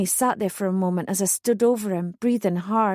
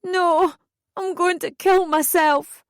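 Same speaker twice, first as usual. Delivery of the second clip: high, slow, distraught cry; high-pitched frantic shout; desperate shout; 9 semitones higher, 3 dB louder, slower than usual. A narrator's reading. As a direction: shout it out, beside yourself. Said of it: "I shouted".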